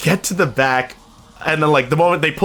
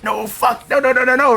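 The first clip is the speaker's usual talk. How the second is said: high, rapid-fire string of no's; retold panic; 10 semitones higher than usual. Hidden passenger as they head to the back, acted panic. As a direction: act out panic, pitch jumping up. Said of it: "I fucking panicked, just like".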